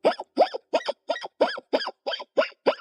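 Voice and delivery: very high squealing giggle; squeaky laugh. Real, not acted. Laughing at chat.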